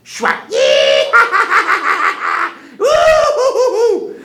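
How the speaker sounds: loud high whooping cackle; wild cackling whoops. Acted. Hairy man chopping the tree, wild whooping cackle. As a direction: whoop and cackle like a mad beast.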